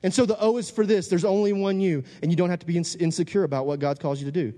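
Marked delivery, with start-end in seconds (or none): 0.0-2.0 high-pitched, urgent sermon delivery; fired-up preacher; impassioned preaching. Real, not acted.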